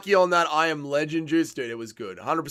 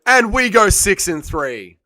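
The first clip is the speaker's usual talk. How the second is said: loud, raised, drawn-out celebration; triumphant announcement; 4 semitones higher, 8 dB louder, slower than usual. Real, not acted. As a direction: announce the score slowly and proudly loud. Celebrating a winning pick.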